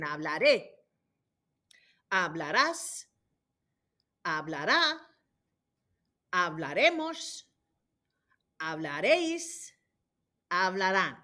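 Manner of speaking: hablaré, Hablarás, hablará, hablaremos, hablaréis, hablarán, each stressed on the accented vowel; stress on accented endings. Shown as a demonstration. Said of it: "The accents are hugely important".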